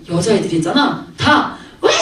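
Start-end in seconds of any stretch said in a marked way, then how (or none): 1.8-1.9 imitated crowd shriek; short, high squeal mimicking screaming fans; hammed-up fan scream; mock fangirl scream. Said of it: "하고 또 소리를 막 지른대".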